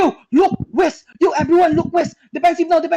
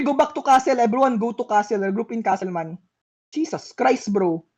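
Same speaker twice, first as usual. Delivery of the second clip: usual speaking voice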